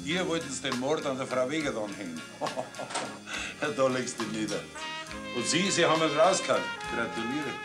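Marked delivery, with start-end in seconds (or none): 2.2-3.6 short breathy laugh. Acted. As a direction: laugh once, amused. Scornful laugh at the police.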